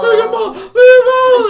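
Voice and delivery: put-on high, loud wailing child's cry. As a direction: cry out like a toddler missing mom. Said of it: "and she cried".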